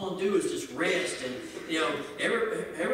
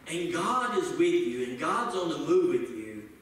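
ordinary talk

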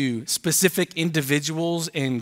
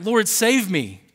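higher, slow cry of a sinking man; cry for rescue; 6 semitones higher, 3 dB louder, slower than usual. A narrator's reading. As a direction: plead to be saved, drawn out. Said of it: "and he cried out".

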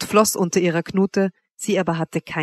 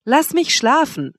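higher, slow rebuff; irritated, sleepy; 7 semitones higher, slower than usual. A narrator's reading. Wanda sends lover away, irritably wanting sleep. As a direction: shut the pleading out, drawing it out.

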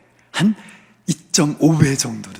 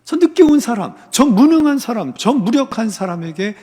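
high, indignant protest, acted out; resentful complaint; 5 semitones higher than usual. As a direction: complain bitterly as a worker wronged by the boss. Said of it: "이렇게 주인께 원망한 거예요".

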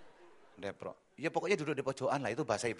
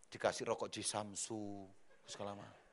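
ordinary talk, nothing marked

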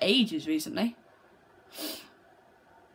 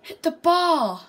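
very high, loud, slow cry; astonished; 9 semitones higher, 11 dB louder, much slower than usual. Real, not acted. Gamer sees a shot hit the bar, high slow cry. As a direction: let it burst out in disbelief.

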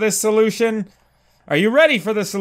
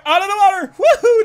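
much higher, excited cheer rising into a whoop; gleeful whoop; 4 dB louder, faster than usual. Real, not acted. Player bursting out of the water, high whoop.

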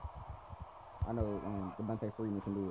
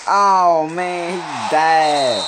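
high, loud groaning cry; real dismay, pitched up and loud; 6 semitones higher, 9 dB louder, much slower than usual. Real, not acted. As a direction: cry out as the play goes wrong. Dismayed at a play in game.

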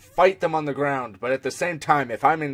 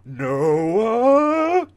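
calling out; 5 semitones higher than usual. Acted. Calling out a reference line.

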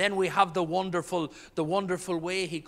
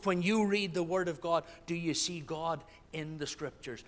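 ordinary talk, nothing marked

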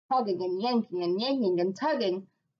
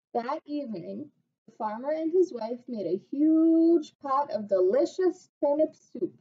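ordinary delivery, nothing marked

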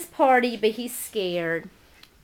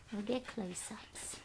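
whisper; 15 dB quieter than usual. Real, not acted. Filming quietly near the dogs.

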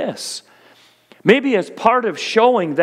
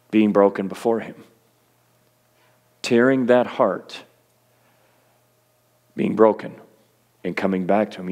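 hushed altar call; 6 semitones lower than usual. Acted. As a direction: draw them gently into brokenness. Quiet call to repentance.

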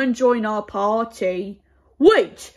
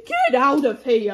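ordinary talk, nothing marked

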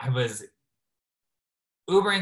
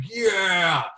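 crowd cheer; 7 semitones higher, 6 dB louder than usual. Retold or imitated. Drunk crowd cheering, quoted.

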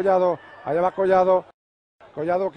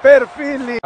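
breakneck goal commentary; 6 semitones higher, 6 dB louder, much slower than usual. Real, not acted. Goal call, rising.

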